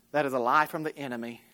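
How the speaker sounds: ordinary talk, nothing marked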